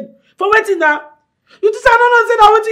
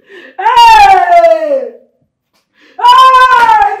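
loud, long-held wailing cry; anguished wail; 9 dB louder than usual. Acted. Sister laments what she discovered, anguished wail.

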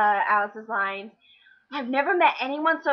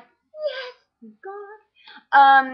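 mock relief cheer; 10 semitones higher, 9 dB louder, much slower than usual. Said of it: "I'm like".

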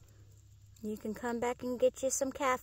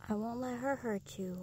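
ordinary talk

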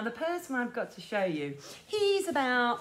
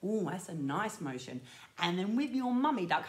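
ordinary talk

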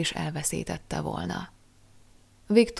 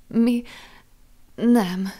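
gasped out; 5 semitones higher, 5 dB louder than usual. Acted. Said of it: "nyögte ki".

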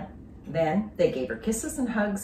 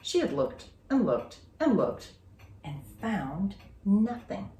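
plain reading voice

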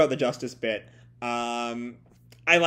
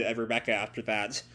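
ordinary talk

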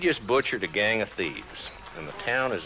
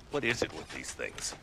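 normal talking voice

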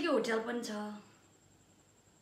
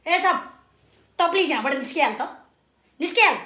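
loud, urgent dismissal; exasperated order; 9 dB louder, much faster than usual. Acted. Telling sir to please leave right now, exasperated. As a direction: send him off, loud and impatient.

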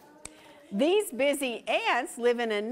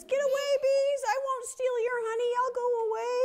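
very high, squeaky bear begging; frightened bear voice; bear pleading; 12 semitones higher, faster than usual. A narrator's reading. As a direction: beg the bees to leave you alone, way up high. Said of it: "He says".

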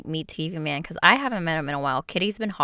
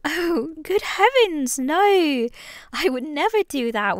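put-on British accent; 7 semitones higher, 3 dB louder, slower than usual. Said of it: "the Southern and the British one".

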